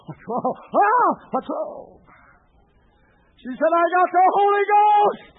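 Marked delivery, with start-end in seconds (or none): 0.1-2.0 startled high cry, much louder; alarmed. Retold or imitated. Startled vicar crying out at his screaming wife. 3.7-5.3 high, loud, slow ecstatic cry. Retold or imitated. Wife ecstatically telling her husband the news.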